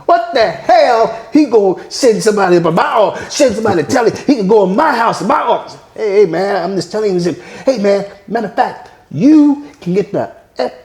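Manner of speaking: mock-furious father telling him off; angry father rant.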